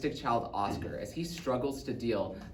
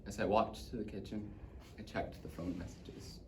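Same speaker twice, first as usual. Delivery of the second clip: very soft, lower narration; hushed dread; 4 semitones lower, 10 dB quieter than usual. Acted. Boy recalling checking the phone messages, hushed. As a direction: sink into a near murmur.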